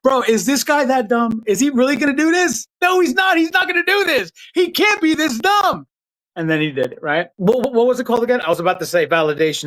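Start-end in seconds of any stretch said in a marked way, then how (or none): 0.0-5.9 mock incredulous China. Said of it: "And China was like".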